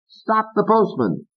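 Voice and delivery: very high, slow order; Fats. Acted. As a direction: command him.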